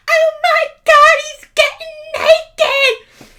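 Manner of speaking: very high, loud, slow shrieking scream; hammed-up high screech of disbelief; mock girl scream. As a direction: shriek it like a shocked teenage girl. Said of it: "hear that scream again".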